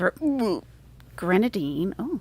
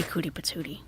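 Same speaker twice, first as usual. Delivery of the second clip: ordinary delivery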